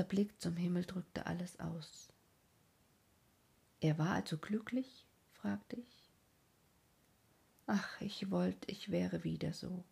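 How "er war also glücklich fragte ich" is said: plain reading voice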